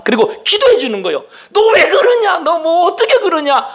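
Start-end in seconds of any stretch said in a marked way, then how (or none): 1.5-3.7 high, laughing mock rebuke; mock scolding. Preacher mimicking an immature believer scolding another, high mock rebuke.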